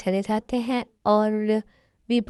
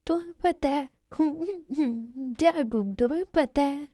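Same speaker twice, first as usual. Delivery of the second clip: high, broken, sobbing voice; acted sobbing confession; crying; 4 semitones higher, slower than usual. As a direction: struggle to speak through tears. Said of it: "इतना क्यों रो रही हो".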